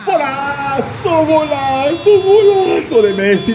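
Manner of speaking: long, high, drawn-out golazo; stretched goal call. Real, not acted. Celebrating Messi goal, drawn out.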